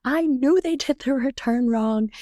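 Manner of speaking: pleased vindicated retelling, a bit hammed-up; mock triumphant. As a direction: crow that you were right all along. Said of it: "And I was like".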